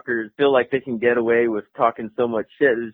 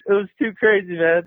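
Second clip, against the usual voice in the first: ordinary delivery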